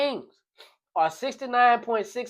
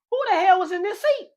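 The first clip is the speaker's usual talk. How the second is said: suspicious; 8 semitones higher, faster than usual. Acted. Boyfriend suspicious outburst.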